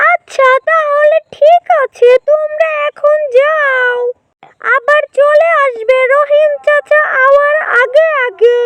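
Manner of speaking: high storybook animal voice, agreeable; lightly voiced animal friends. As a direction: lightly play animals setting off together.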